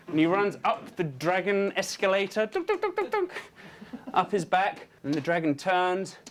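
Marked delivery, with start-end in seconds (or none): 2.4-3.3 very high, rhythmic "dunk" steps; footstep sound effect. Acted. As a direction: patter the "dunk"s high, climbing. Imitates running up dragon's back.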